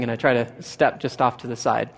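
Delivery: ordinary talk, nothing marked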